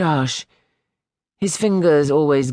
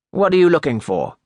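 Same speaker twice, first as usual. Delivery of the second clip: stern schoolmaster; 4 semitones higher, 4 dB louder than usual. A narrator's reading. Schoolmaster questions new boy.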